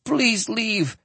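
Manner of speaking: high, frightened plea; begging. Acted. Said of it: "he begged".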